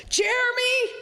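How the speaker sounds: high, loud held cry of the name; dying mother's yell. Retold or imitated. Dying mother calls for her favorite, yelling. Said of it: "and she yells".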